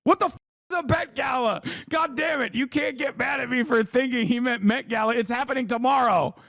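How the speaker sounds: high, fast confused squawk; baffled outburst. Real, not acted. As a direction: voice jumps way up in confusion. Confusion over the Bet Gala.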